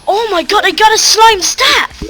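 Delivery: real excitement climbing high and loud; thrilled. Real, not acted. Gamer announces a rare slime staff, thrilled. Got rarest item in game.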